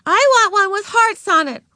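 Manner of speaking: put-on high, loud childish wail; high-pitched kid's demanding wail; wailing little girl. A narrator's reading. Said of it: "wailed a girl".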